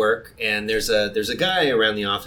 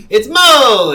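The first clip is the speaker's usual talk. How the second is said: excited shout; 11 semitones higher, 12 dB louder than usual. Retold or imitated. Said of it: "And it was like".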